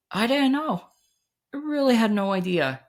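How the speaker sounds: high, helpless disbelief; bewildered. Retold or imitated. Retelling his confusion, high and bewildered. Still reliving the panic.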